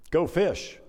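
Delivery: ordinary delivery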